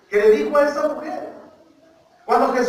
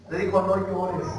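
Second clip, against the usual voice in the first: lower, softer retelling of comforting words; quietly quoted consolation; hushed quote; 7 semitones lower, 8 dB quieter than usual. Retold or imitated. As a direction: drop the volume for a gentle quote. Preacher hushes to quote Jesus.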